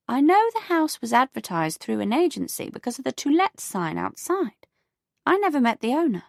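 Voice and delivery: put-on a prim elderly neighbour; prim older neighbour. Beatrice voiced.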